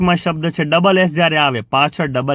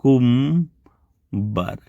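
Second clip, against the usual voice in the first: syllable by syllable; 2 semitones lower, much slower than usual. Shown as a demonstration. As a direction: sound out each syllable with pauses. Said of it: "તમે કહેશો કુ".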